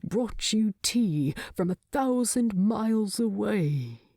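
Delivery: usual speaking voice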